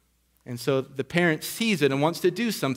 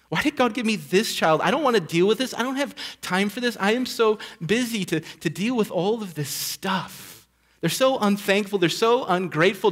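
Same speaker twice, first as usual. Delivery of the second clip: higher-pitched exasperated parent grumbling to themselves; muttering parent; 6 semitones higher than usual. Acted. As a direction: complain like a worn-out, fed-up parent. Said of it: "The parent mutters under his or her breath".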